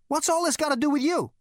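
loud, very high-pitched challenge; demanding. A narrator's reading. Zaphod presses Rooster on his part, demanding. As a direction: push the question hard, near shouting. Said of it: "demanded Zaphod".

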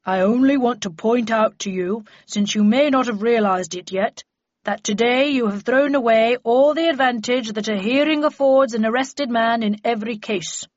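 loud, solemn official voice; deep-voiced magistrate. A narrator's reading. Magistrate admonishes the accused behind the door, deep-voiced. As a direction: pronounce it weightily, like a judge. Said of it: "a deep voice said".

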